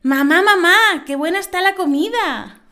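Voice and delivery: put-on the girl repeats it, missing the joke; little girl voice.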